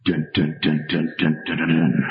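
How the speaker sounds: low mock dramatic music sting; ominous dun dun. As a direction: hum a deep ominous drumroll. Dramatic sound effect before reveal.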